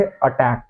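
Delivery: अटैक opened with a short "uh" vowel, "uh-TAK"; common Indian pronunciation. Shown as a demonstration. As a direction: say अटैक the everyday Hindi way, starting on अ. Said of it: "हम लोग इसको बचपन से बोलते आ रहे थे". (अटैक में पहला स्वर छोटा 'अ')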